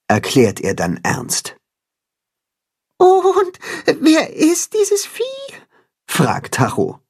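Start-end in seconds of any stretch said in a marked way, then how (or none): 3.0-5.2 high, slow, near tears; high crying question; frightened whimper. A narrator's reading. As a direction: ask it with rising dread. Frightened animal asks about sale.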